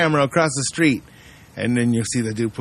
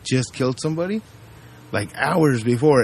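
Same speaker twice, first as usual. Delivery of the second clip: ordinary talk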